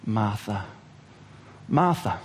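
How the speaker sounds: very low, soft first name; gentle calling. Acted. Jesus calling Martha twice to calm her, soft and tender. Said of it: "he says her name twice".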